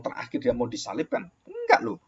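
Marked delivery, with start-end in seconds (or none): 1.5-2.0 much higher, sudden no; surprised correction. Real, not acted. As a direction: catch yourself out loud. Preacher correcting his own claim.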